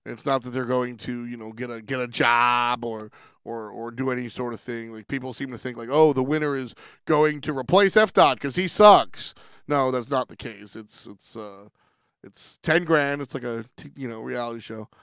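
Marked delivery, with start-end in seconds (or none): 5.9-9.3 mock viewer opinion. Imitating viewers guessing who gets replaced, mocking. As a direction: imitate a know-it-all commenter, louder. Mimicking what viewers think.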